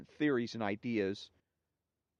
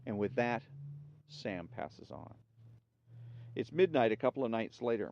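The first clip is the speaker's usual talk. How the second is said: ordinary talk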